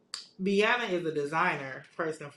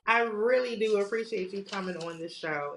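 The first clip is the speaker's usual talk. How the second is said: normal talking voice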